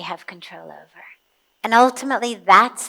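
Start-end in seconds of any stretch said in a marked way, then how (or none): 0.0-1.0 hushed, breathy aside to the audience; whisper. Real, not acted. Teacher whispering the one outcome we control. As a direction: lean in and whisper the key point.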